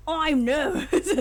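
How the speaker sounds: slow, loud, hammed-up Australian accent. Streamer auditioning a voice for the main character, exaggerated Australian accent. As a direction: push the Australian accent high and drawn out.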